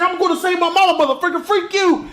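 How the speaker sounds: hammed-up squeaky scolding; high cartoon voice. Flash scolding his past self in a squeaky voice.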